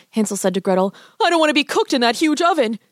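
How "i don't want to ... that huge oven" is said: put-on high frightened young boy; scared boy voice. A narrator's reading. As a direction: plead like a scared little boy. Boy dreading the oven.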